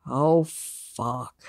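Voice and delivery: low, mock-dismayed self-questioning; dropped-pitch, half-acted dread; morning-after regret. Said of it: "a next day going".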